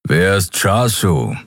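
langsam, leicht knurrende Löwenstimme; geknurrt. A narrator's reading. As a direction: misstrauisch nachgrollen. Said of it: "knurrte Keru". (Keru knurrt leicht)

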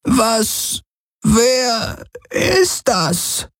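high, slow, puzzled alien voice; elder puzzled by the stranger; Kesli voice. A narrator's reading. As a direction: draw out the question, high. Kesli asks about stranger.

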